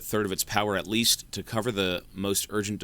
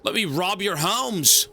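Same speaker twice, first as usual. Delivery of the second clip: high, slow mocking villain voice; mock greedy villain; 11 semitones higher, 6 dB louder, slower than usual.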